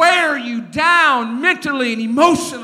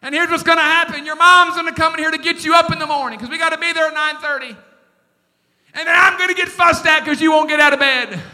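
fast, loud, scolding rush; fast, loud scolding of a son at night; exasperated dad lecture; 4 semitones higher, 5 dB louder, faster than usual. Retold or imitated. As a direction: rattle off the consequences like a tired father. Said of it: "I said".

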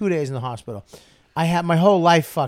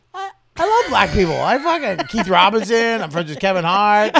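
loud, high stammering protest; hammed-up panicked defense; 6 semitones higher, 5 dB louder than usual. Comic answering a public accusation, frantic high protest. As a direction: blurt names loudly in mock desperation.

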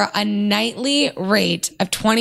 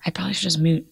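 whispering; 3 semitones lower, faster than usual. Real, not acted. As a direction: keep it hushed. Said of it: "Why are we whispering?".

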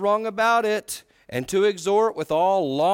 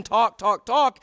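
impatient, raised-pitch repeated command; upset insistence; 5 semitones higher, 5 dB louder, slower than usual. Retold or imitated. Upset listener pretending to invite talk, impatient re-enactment. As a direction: order them to talk with mock impatience. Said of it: "And I'm just upset, even though I'm saying".

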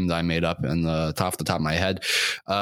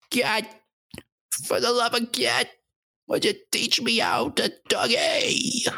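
desperate yelling plea. Acted. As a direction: beg at the top of your voice. Begging to learn the Dougie.

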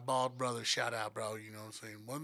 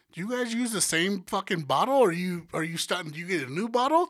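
shocked disbelief; 4 semitones higher, 7 dB louder than usual. Retold or imitated. To the Puerto Rican guys, stunned by the reused bottle. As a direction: pitch jumps up, louder, incredulous.

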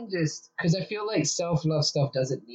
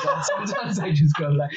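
ordinary delivery

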